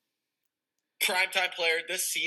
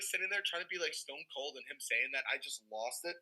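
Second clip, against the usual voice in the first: ordinary talk, nothing marked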